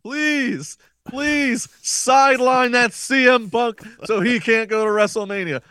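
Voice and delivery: high, drawn-out mock preacher pleading; preacher's prayer. Wrestling analyst imitating a wrestler's confession to the Lord, hammed-up preacher. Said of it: "like Brotherhood and Brother LeBar".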